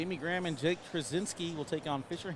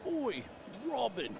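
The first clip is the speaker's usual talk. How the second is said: a jokey Australian Oi, Robin, pitched up; playful Australian Oi to a viewer from Australia; Australian accent; 7 semitones higher, slower than usual. Acted. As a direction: greet an Aussie viewer with a light Australian Oi. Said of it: "accent right there?".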